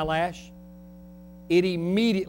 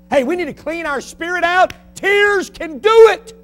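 fiery preaching; 11 semitones higher, 10 dB louder than usual. Acted. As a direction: cry it out to the hall. Preaching at full force.

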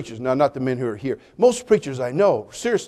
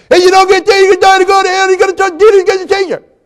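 much higher, much louder garbled preaching yell; slurred street preaching; 14 semitones higher, 9 dB louder than usual. Retold or imitated. Carl preaching to sailors from a trash can, garbled yell. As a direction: shout the sermon with a thick speech impediment. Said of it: "horrible speech impediment ... preaching".

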